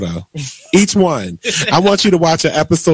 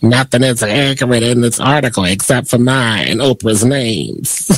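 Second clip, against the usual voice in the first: low put-on character voice; lightly hammed-up impression, pitched down; Madea; 4 semitones lower than usual. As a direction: read a quote in a jokey, altered voice. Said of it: "That was my Madea voice".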